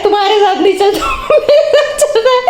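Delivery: rising, high mock childish insistence dissolving into laughter; laughing mock of a stubborn boy; stubborn kid. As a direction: imitate the little brother's stubborn reply, laughing. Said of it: "कह रहा है".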